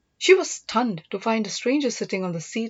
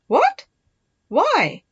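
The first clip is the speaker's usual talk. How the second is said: shocked disbelief; 11 semitones higher, 7 dB louder than usual. Acted. Sister shocked by brother's plan.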